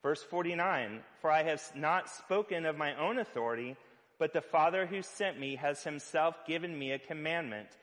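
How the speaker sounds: usual speaking voice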